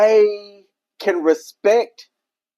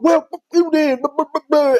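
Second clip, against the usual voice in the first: higher-pitched imitation of a rejected man's rant; mock angry outburst; 7 semitones higher than usual. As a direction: mimic someone blustering off offended. Mimics a rejected man's rude outburst.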